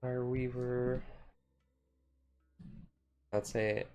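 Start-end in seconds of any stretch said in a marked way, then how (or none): none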